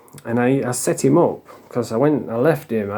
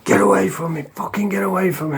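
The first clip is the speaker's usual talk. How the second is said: retold hallucinating screams; 7 semitones higher than usual. Retelling the man screaming at hallucinations, high mock screaming. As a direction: imitate a panicked scream at restrained volume. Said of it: "he was just screaming and shouting".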